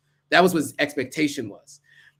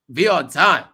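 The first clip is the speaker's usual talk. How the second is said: forceful; 6 dB louder than usual. Shown as a demonstration. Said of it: "repeating the rule louder".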